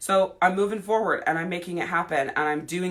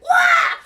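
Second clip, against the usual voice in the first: sudden high shriek of panic; freak-out yell; 8 dB louder than usual. Pretend. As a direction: let out a startled squawk. Body freaking out.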